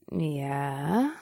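low, soft drawl with a rising end; lazy, drawn-out questioning reply. Acted. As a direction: draw the word out into a question. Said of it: "Nix drawled".

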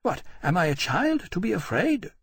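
a touch of royal scorn; scornful. A narrator's reading. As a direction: scoff at the idea of fear. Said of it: "she said scornfully".

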